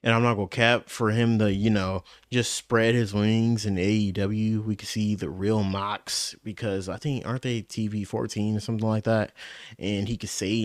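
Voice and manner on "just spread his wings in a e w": usual speaking voice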